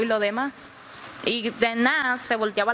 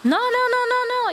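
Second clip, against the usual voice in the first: high, loud string of refusals; acted emphatic refusal; 14 semitones higher, 4 dB louder, slower than usual. Fishermen refusing the begging passengers, emphatic refusal. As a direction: shut the pleading down at the top of the voice.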